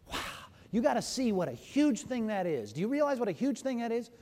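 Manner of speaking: ordinary talk, nothing marked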